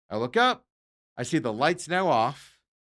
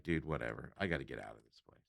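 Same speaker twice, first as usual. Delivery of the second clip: much softer, lower, fed-up mumble; low exasperated muttering to himself; annoyed mutter; 9 semitones lower, 12 dB quieter than usual. Retold or imitated. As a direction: grumble it under your breath.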